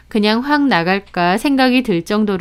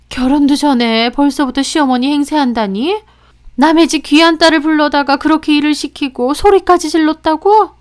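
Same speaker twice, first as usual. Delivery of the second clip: higher-pitched indignant mother, rising; appalled disbelief; 6 semitones higher, 3 dB louder than usual. Acted. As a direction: voice a mother shocked for her daughter. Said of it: "저희 엄마도 황당해하시면서".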